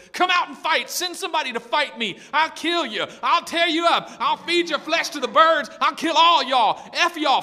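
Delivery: high, loud taunting challenge; screaming giant. Acted. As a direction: bellow threats as a defiant giant. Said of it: "humongous giant is over here screaming".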